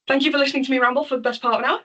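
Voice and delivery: rattled off fast and bright. Real, not acted. Speaker thanks audience at end, quick and bright.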